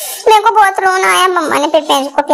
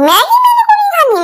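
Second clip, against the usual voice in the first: much higher whining refusal; sulking child refusing Maggi, whined much higher; whiny demand; 14 semitones higher than usual. Retold or imitated. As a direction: whine it way up high like a sulking kid. Child demanding mango from mom.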